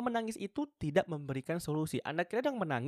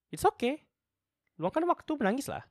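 high encouragement; 12 semitones higher, 4 dB louder than usual. Real, not acted. Comforting viewers who want to cry.

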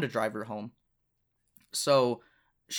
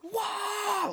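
mock scream. Showing how the girl screamed while jumping, mock scream. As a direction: imitate someone screaming in a squealed falsetto. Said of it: "screaming, jumping up and down like this".

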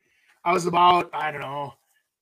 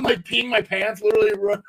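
ordinary talk, nothing marked

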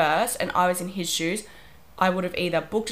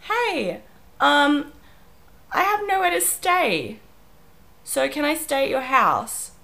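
higher, light, asking-a-favour voice; innocent request; 7 semitones higher, slower than usual. Retold or imitated. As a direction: voice the text like a hopeful ask. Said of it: "something along the lines of".

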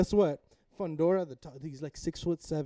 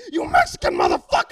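high, loud raw fan yell; carried away; 11 semitones higher, 12 dB louder than usual. Retold or imitated. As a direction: re-enact screaming at the fight. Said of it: "I get carried away".

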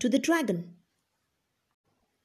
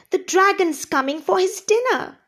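high, louder gnome squeak of fright; frightened squeak; 8 semitones higher, 4 dB louder than usual. Acted. Trembling gnome hearing the dragon coming, high squeak. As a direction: squeak it out as a scared little gnome. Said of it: "he squeaked".

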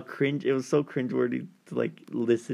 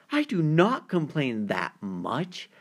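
slow, higher, drawn-out protest; mock indignant; 5 semitones higher, slower than usual. Girl caller objecting to her date's criticism, mock indignant. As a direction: imitate an offended caller, stretching the words. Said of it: "And she's like".

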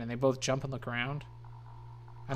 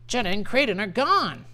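alarmed cry; 8 semitones higher, 5 dB louder than usual. Acted. Friend cries out about abduction.

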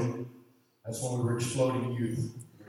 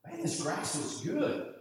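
impressed guest; 6 semitones higher, 5 dB quieter than usual. Retold or imitated. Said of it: "They said".

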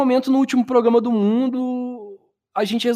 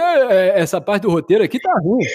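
put-on grumpy old man complaining; creaky elderly grumble, lightly done; 4 dB louder than usual. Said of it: "com voz de velho". (voz de velho rabugento)

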